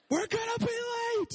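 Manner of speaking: high panicked mock yell; hammed-up cry of running late; stressed shout. Jesus shouting at Peter about being late, high mock panic.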